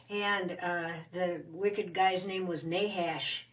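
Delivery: usual speaking voice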